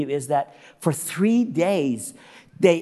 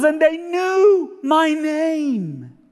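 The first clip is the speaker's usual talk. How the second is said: high wail, very drawn out; emotional cleaning lady; 13 semitones higher, 5 dB louder, much slower than usual. Retold or imitated. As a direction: stretch it into a weepy mock wail. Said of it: "I'm just sorry to be emotional".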